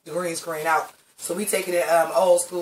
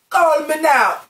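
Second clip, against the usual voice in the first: Miss Cleo impression; 7 semitones higher, 4 dB louder, slower than usual. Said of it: "Shangela initially said she was doing Miss Cleo".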